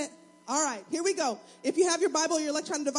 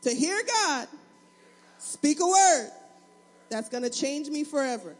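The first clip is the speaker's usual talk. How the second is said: louder, spaced declaration with pauses; led affirmation; 5 dB louder than usual. Shown as a demonstration. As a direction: proclaim it phrase by phrase.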